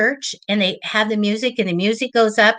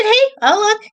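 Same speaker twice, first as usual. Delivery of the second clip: eager offer; 12 semitones higher, 5 dB louder than usual. Retold or imitated. Offering to check for client.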